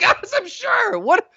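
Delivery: very high, frantic exasperation; freaking out. Retold or imitated. Player imitating a bomb-game argument, freaking out. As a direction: drag it out in a squealing panic. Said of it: "Sam freaking out at me".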